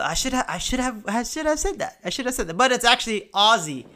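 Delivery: ordinary talk, nothing marked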